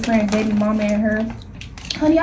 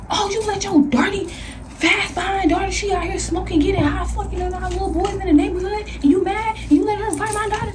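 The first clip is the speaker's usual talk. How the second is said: higher pitched heated mock rant; mom going off; 7 semitones higher than usual. Mom yelling at the girl's mother, high heated mock rant. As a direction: imitate mom tearing into the other mother.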